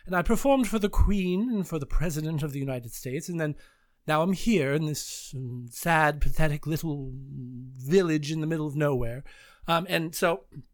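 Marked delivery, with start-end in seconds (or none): 0.1-9.2 slow, calculated, very proper British; snooty British accent. Retold or imitated. Student improvising a grand actor on stage, snooty slow British. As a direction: put on a bad, overly proper British accent. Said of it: "everything was very slow and very calculated".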